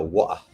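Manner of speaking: wa'ah with the t dropped to a glottal stop; northern UK wa'ah. Shown as a demonstration. Lecturer showing students northern English wa'ah. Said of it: "Wa'ah would be the northern U K side".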